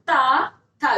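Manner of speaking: ता, था as loud dental syllables; sound demonstration. Shown as a demonstration. Teacher demonstrating teeth sounds, ता, था sounded out loudly. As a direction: punch ता, था separately, tongue on the teeth. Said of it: "ता मतलब वर्ग को बोलिए".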